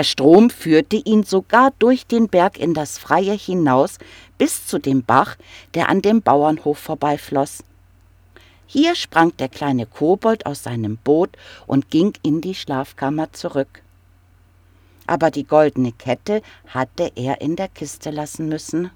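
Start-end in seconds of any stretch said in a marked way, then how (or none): none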